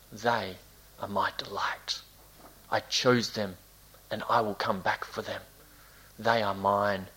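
low, soft, spaced-out phrases; tender assurance. Acted. God looking at Israel, tenderly reassuring. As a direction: claim them quietly and surely.

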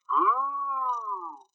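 high, soft, long-drawn ghostly call; eerie, swooping mock-spooky cry; crazy lady. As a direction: imitate a batty woman softly wailing. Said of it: "this role of this crazy lady".

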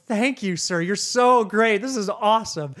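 high, effusive gratitude; acted-out grateful gushing; gushing thanks. Gushing thanks to help desk guy.

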